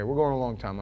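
normal talking voice

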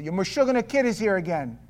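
loud scolding grandmother; a scolding Yiddish grandmother calling out. Retold or imitated. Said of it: "She used to call my mother and say".

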